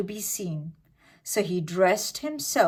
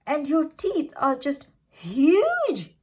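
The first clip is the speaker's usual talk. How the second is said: put-on slightly higher, slow amazed child; a little girl gasping at the teeth; exclaimed; 8 semitones higher, 3 dB louder than usual. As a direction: marvel at the size.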